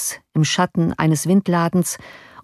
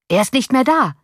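crying out; 7 semitones higher than usual. A narrator's reading. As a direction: call out that he is gone. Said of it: "rief dieser Mensch".

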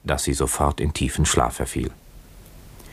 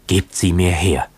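blurted out; 3 semitones higher, 4 dB louder than usual. A narrator's reading. Kokua bursts out urgently at the old man, blurted. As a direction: blurt it out, grabbing for the bottle. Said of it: "stieß Kokua hervor".

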